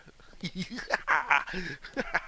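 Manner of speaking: loud, high cackle; laughing at your own clumsiness; amused laugh. Real, not acted. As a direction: break into a big high laugh. Laughs at microphone bump.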